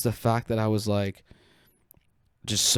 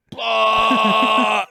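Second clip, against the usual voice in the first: loud, high projectile-puke noise; hammed-up spew, held out long and loud; imitated vomiting; 7 semitones higher, 7 dB louder than usual. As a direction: blurt out a big mock retch. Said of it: "I literally went like this".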